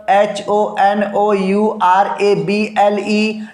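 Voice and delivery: letters spelled out slowly one by one; slow spelling out. Shown as a demonstration. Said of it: "हमने कहा an honorable".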